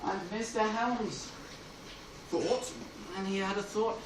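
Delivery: ordinary talk, nothing marked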